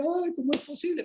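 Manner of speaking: high, soft mock wail; llanto imitado. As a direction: imitate a tearful woman's disbelief. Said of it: "la mujer solo lloraba y decía". (llanto fingido, agudo y suave)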